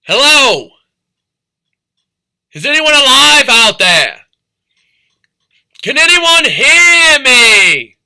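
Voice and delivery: high, loud calling shout across the water; Titanic rescue officer. Acted. Rescue officer calling over the water for survivors, loud shout. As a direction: call out into the dark sea for survivors. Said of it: "that's me being the Titanic rescue officer".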